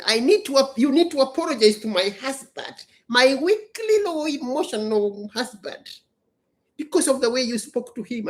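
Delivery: a hint of a demanding wife on voicemail; imitating the wife. Wife leaves a message demanding an apology, lightly mocking. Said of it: "She left a long message saying".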